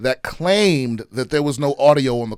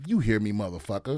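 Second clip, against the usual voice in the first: softer, lower punchline, laughing; laughing through it; 6 semitones lower, 7 dB quieter than usual. Retold or imitated. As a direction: chuckle out the mock retort. Retold phone call, laughing.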